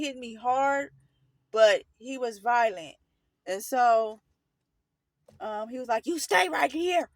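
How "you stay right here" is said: imitated forceful command, pitched up; retold angry order, firm and raised; stern order. Violent man ordering a child to stay put, mock stern command.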